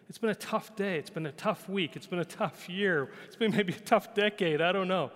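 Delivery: ordinary talk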